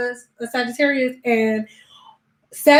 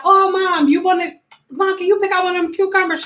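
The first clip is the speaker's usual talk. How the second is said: high, fast pleading to mom; high-pitched daughter begging mom; whiny kid asking mom; 7 semitones higher, faster than usual. Retold or imitated. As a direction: sweet-talk your mom into it, quick and high. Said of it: "and I'll be like".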